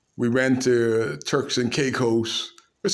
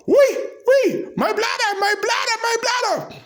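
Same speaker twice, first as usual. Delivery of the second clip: mock holler. Kitchen staff hollering in mock panic, squealed high. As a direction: shriek it up high like a hollering cook. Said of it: "They should like to holler".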